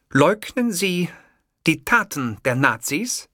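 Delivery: stern reporter, slowly; pointed interviewer. Acted. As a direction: lay each word down like an accusation. Interviewer presses hard.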